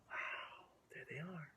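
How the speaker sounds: soft whispered wonder. Real, not acted. Hushed aside pointing at picture.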